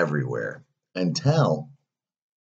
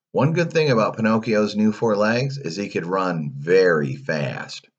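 ordinary delivery, nothing marked

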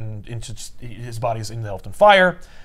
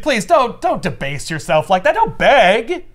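mocking scold; 7 semitones higher, 4 dB louder than usual. Villain scolding her begging.

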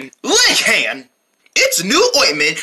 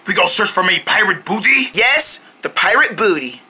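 ordinary delivery

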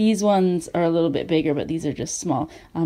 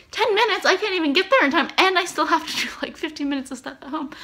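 high, quick mock disbelief; retold incredulous reply, rushing along; incredulous protest; 7 semitones higher, faster than usual. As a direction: protest the impossible timing, pitched high. Acting out her indignant reply to restaurant.

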